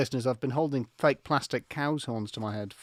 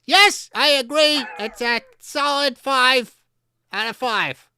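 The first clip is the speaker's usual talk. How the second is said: higher, louder, slow Lynch cow agreeing; a high, slow cow judge giving a solid five; David Lynch cow; 9 semitones higher, 6 dB louder than usual. Pretend. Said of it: "David, are you in agreement?".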